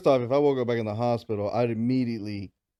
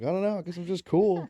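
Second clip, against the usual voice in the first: nonchalant, pitched-up brag; smug shrug; 7 semitones higher than usual. Retold or imitated. Answering the bar crowd, a mock cool shrug. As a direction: play it off like it's nothing. Mock-casual reply to drunk question.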